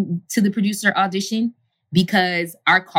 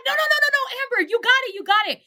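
much higher, rapid eager no no no; the agent stopping her hanging up, thrilled; excited insisting; faster than usual. Retold or imitated. Agent rushing to tell her she got the part, excitedly. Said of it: "She's like".

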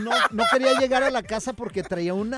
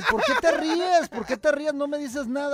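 puzzled retold plea; 6 semitones higher than usual. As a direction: beg her to explain the laughing. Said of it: "Y nada más se reía".

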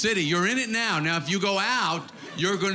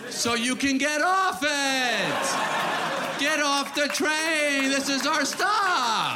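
higher, slow, drawn-out goofy answer; silly mock voice; 5 semitones higher, much slower than usual. Comic mocking Long Islanders, slow silly higher voice. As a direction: stretch it out like stating the obvious to a child.

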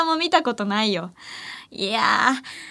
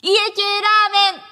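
dramatic reveal; 7 semitones higher than usual. Pretend. Revealing her first-ever dish to listeners, grandly. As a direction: unveil it like a game show prize. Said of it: "エコーつけてくれて".

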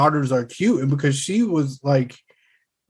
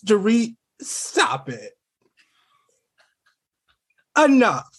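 Rinna impression; 6 semitones higher, slower than usual. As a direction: scold Dorit like Rinna. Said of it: "my Renna voice".

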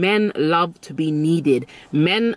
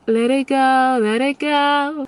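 much higher, drawn-out let it go; high airy release; 9 semitones higher, 3 dB louder, slower than usual. Shown as a demonstration. Coach showing how to let go, rising high. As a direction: float the words up and away. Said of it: "Knowing how to let it go".

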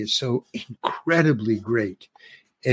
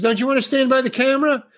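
called out; 9 semitones higher, faster than usual. Retold or imitated. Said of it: "He yells to the cameraman".

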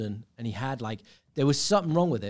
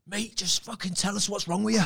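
high-pitched mock exasperation; pitched-up imitation of a fed-up heckler; exasperated heckler; 8 semitones higher than usual. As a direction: imitate an audience member demanding an answer. Retold heckler standing up at show.

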